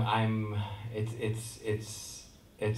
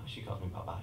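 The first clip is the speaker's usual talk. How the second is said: normal talking voice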